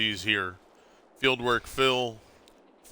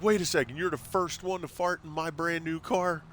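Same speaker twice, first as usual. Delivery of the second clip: high, pitched-up mock outrage; mock freak-out; 8 semitones higher than usual. Dad fake-panicking at daughter over new car, higher mock outrage. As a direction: play up the shock over a brand new car. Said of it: "So I started freaking out".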